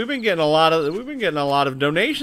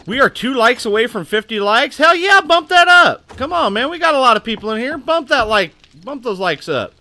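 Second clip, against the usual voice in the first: loud, high hype for likes; hyped up; 8 semitones higher, 5 dB louder than usual. Real, not acted. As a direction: pump up the chat. Hyping chat for likes.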